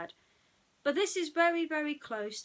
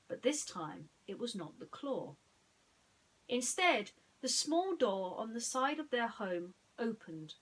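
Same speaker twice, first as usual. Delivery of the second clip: plain reading voice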